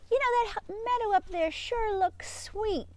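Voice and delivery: high pleading sheep asking Murphy. Acted. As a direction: beg the sheepdog like an excited lamb.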